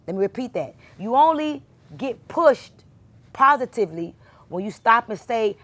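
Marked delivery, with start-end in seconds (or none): 1.0-2.8 high, loud and slow on pushed; emphatic preacher's stress on You only get pushed; emphatic preaching. Real, not acted. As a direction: lean hard into each word like a preacher. Preacher stressing key point.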